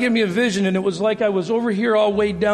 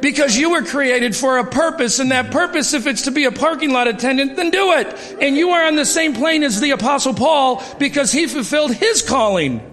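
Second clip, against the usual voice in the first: higher, more forceful sermon voice; rising preaching; 4 semitones higher than usual. Acted. Pastor urging the congregation about their equal callings, in a rising preaching voice. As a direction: drive the point home to the congregation.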